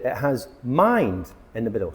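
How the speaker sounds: usual speaking voice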